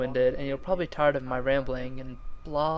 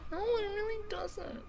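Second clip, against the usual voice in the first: high nasal whine, like Napoleon Dynamite's brother; whining voice; 10 dB quieter than usual. Pretend. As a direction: whine it out like a mopey teen. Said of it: "I do a very well whining voice".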